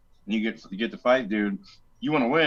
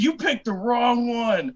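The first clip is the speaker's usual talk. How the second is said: threatening opponent; 11 semitones higher, 3 dB louder, slower than usual. Retold or imitated.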